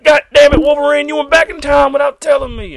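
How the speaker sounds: high, loud mock outburst; angry yell. Hero yelling at Wolverine, high loud mock rage. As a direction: shriek it in comic fury. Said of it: "he's like".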